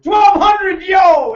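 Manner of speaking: high, loud shout of fake delight; mock excitement. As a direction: hype the number up like a party. Mocking excited refund reaction.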